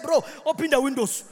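normal talking voice

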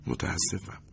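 hushed, apologetic murmur; softly. A narrator's reading. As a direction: say it softly, backing off gently. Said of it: "آهسته گفت". (آهسته و عذرخواهانه)

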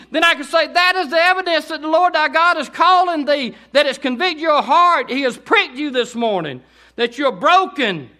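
high, loud fervent preaching; full-force preaching. Acted. Preacher urging the congregation, shouting fervently. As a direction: preach it with fire.

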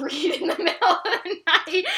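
laughing. Real, not acted. Laughing at own plan.

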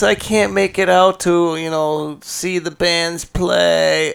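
high, slow whiny mimicry; mock a lame excuse, whining. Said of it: "He's pulling these excuses".

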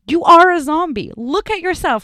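urgent emphatic voice; insistent explaining. Retold or imitated. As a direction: make her realize she's a zombie. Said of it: "the guy's like".

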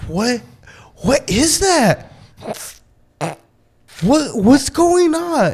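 high, slow bewildered questions; stunned disbelief. Retold or imitated. Dad hearing his kid's strange laugh, high and slow disbelief. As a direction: act baffled and alarmed.

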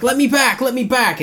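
high, urgent mock pleading; imitated freak-out. Boy freaking out in the car, imitated desperate pleading. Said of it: "he was, like, freaking out in the car".

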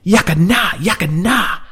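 hissed angry whisper, high. A narrator's reading. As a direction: hiss it out in fury, half-shouted. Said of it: "he hissed in an angry whisper".

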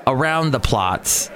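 normal talking voice

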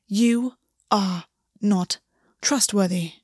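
"You are not trustworthy" slow, a pause between words; each word separate. A narrator's reading. August condemns Gwen, "You are not trustworthy" slowly word by word. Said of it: "felt each one of August's words like a blow".

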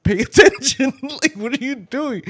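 mock shout of disbelief; exasperated yell. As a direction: yell at the reckless driver to pay attention. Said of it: "And I'm like".

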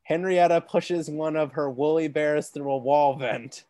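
usual speaking voice

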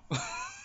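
sudden high giggle; high burst of laughter. Real, not acted. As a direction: crack up laughing. Breaks up after reading line.

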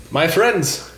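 a hint of a warm emperor; regal emperor greeting. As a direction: greet old friends from the throne.

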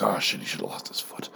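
hushed aside, breath only, no voice; whisper. Retold or imitated. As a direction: drop to a secret whisper for the punchline. Retelling his reaction to the video.

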